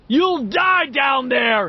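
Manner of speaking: slow, loud, high threat; bully roaring a threat down; angry shout. A narrator's reading. Henry furious at the kids, shouting threat. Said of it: "Henry shouted".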